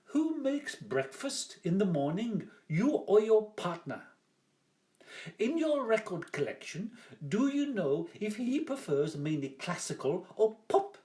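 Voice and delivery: high, bright-toned game show host reading questions; quiz host voice. Retold or imitated. Artist retelling the quiz show questions in a mock host voice. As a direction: ham up the cheesy quizmaster. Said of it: "such as".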